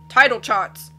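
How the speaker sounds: a jolly captain who bellows everything; blustery sea captain. Acted. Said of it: "the captain cried".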